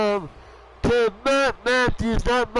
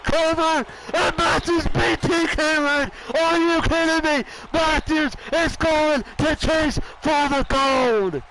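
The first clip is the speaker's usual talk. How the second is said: excited shout; 5 semitones higher than usual. Real, not acted. Commentator calling the upset win, shouting. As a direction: roar the win to the crowd. Calls the winning pin.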